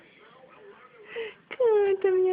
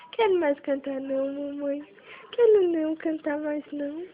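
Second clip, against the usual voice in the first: voicing the baby; 5 semitones lower, 5 dB quieter than usual. Mother voices the baby refusing.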